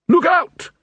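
loud, high warning cry; warning shout. Acted. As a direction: yell a sudden warning.

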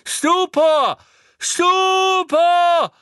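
very high, loud, drawn-out yell; frantic high spell cry; shouted spell. A narrator's reading. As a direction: fling the spell out at full pitch. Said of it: "rief Neville erneut".

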